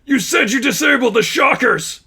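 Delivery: high, loud anguished yell; furious shout through pain. Acted. Miles betrayed by Richie, furious pained yell. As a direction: cry out betrayed. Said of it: "Miles spoke through grinding teeth".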